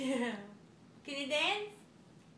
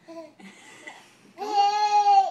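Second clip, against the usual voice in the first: loud laugh; 6 semitones higher, 16 dB louder than usual. Real, not acted. Mother laughing at toddler.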